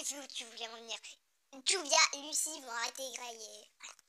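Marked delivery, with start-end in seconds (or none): 1.7-3.9 whispered aside. Real, not acted. Whispering prediction.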